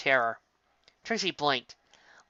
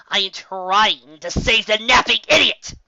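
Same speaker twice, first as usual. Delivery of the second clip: slow, loud, higher character voice; exasperated Trixie voice; 7 semitones higher, 9 dB louder, slower than usual. Acted. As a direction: spell it out in frustration.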